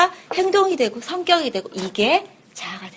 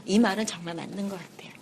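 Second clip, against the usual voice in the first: whispered aside; confiding whisper to the class; 4 semitones lower, 9 dB quieter, faster than usual. Real, not acted. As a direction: breathe it like a secret.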